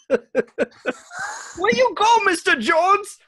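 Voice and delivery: broad caricature cabbie voice, very high; Indiana Jones taxi driver. Acted. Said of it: "sounds like the next taxi driver for Indiana Jones".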